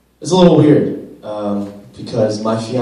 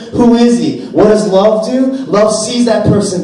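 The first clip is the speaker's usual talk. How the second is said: rising preacher's fervour, higher and louder; fervent preaching; 6 semitones higher, 4 dB louder than usual. Acted. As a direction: press each line home like a pulpit sermon. Preaching to the congregation.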